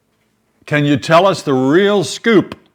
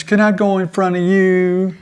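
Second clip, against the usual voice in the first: high, sugary Southern wheedle; mock Southern plea, pitched well up; Southern accent; 7 semitones higher than usual. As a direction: imitate someone sweet-talking in a Southern accent. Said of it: "she has a Southern accent when she wants something".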